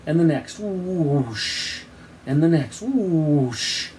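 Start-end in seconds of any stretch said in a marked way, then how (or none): 0.6-1.8 high falling whoosh; long whoosh, sliding downward; whistling bomb whoosh. A narrator's reading. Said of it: "first bomb whistled through the air". 2.8-3.9 falling imitated whoosh; long whoosh with a drop; whistling bomb whoosh. A narrator's reading. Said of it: "first bomb whistled through the air".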